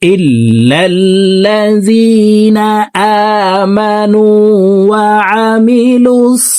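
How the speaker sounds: slow chanted verse, long held end; one breath, melodic tajweed; full verse recitation. Shown as a demonstration. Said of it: "আবার বলছি দেখুন". (এক টানে সুরেলা তিলাওয়াত)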